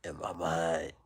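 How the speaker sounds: ordinary talk, nothing marked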